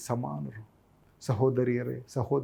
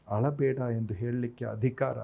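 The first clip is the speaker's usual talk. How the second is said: normal talking voice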